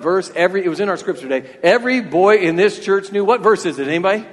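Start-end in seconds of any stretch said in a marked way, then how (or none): none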